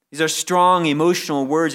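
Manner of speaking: ordinary talk, nothing marked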